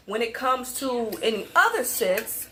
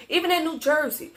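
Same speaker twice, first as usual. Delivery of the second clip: ordinary talk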